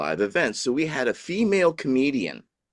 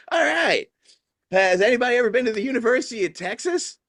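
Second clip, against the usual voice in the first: much higher, fast stand-up crowd question; female comedian voice; 13 semitones higher, faster than usual. Retold or imitated. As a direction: play the comedian firing a setup at the room. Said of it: "And she's like".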